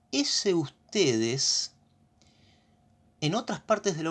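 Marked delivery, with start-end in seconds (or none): 0.1-1.7 "ese ustedes" stretched out very slowly; slow emphasis. Shown as a demonstration. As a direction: linger on "ese ustedes" to make them notice. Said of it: "quiero que presten mucha atención acá". ("ese ustedes" muy lento, para que presten atención)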